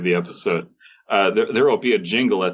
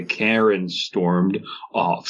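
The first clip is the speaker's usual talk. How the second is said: usual speaking voice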